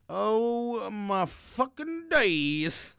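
very slow, high, loud exclamation; hammed-up wail at a huge crowd; mock overwhelmed shock. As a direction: stretch every word in comic dismay. Retold shock at sea of faces.

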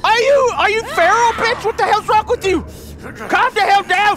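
loud high panicked yelling; loud high scolding yell. Real, not acted. Yelling at tiger.

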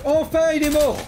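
enfin, il est mort, lancé plus haut et plus fort; soulagement crié. Real, not acted. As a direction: crie ta victoire sur le boss. Boss enfin tué après galère. (cri de soulagement après le boss)

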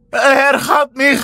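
high, slow terrified cry. Acted. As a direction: cry out in terror. Anja caught by the monster.

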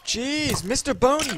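high, loud scolding; exasperated outcry. Real, not acted. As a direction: cry out in protest at the attacker. Attacked again by skeleton.

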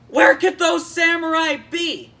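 high, loud outburst as a character; frantic mock shout. Orochi failing to relax in his hot tub, frantic shout. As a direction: shout it in comic alarm.

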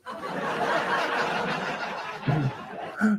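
breathless, voiceless laugh; wheezing laugh. Real, not acted. Streamer cracking up at the chat, wheezing laugh.

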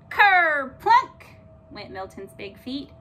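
loud and slow thud; slow emphatic thud. A narrator's reading. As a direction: land each word like a heavy foot. Narrated heavy landing.

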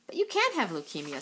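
ordinary delivery, nothing marked

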